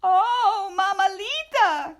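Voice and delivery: frightened cry. Acted. Scapito seeing the Babalito, frightened cry. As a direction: shrink from the shadow. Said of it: "cried Scapito".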